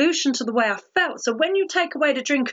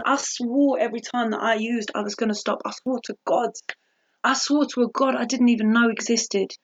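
normal talking voice

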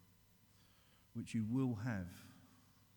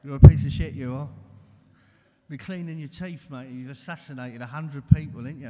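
loud contemptuous abuse; berating taunt; 3 semitones higher, 20 dB louder than usual. Acted. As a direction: tear into him with scorn. Illness berating him in the morning.

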